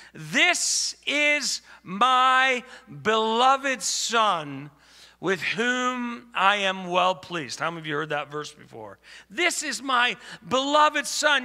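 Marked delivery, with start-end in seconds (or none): 0.1-7.6 slow, loud, high proclamation; God the Father proclaiming from heaven; declaring. Acted. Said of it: "God the Father declares". 9.3-11.4 high, loud proclamation; God the Father's declaration, preached; declaring. Acted. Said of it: "God the Father declares".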